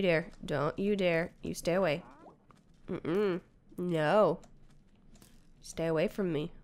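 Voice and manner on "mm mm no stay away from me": ordinary talk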